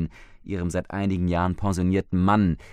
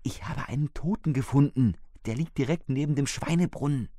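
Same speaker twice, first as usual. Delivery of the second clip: higher, a hint of an anxious wife; lightly voiced frightened older woman; 7 semitones higher than usual. Wife reports the dead man to husband, lightly anxious. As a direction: let a shaken woman report it.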